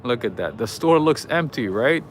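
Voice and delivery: ordinary delivery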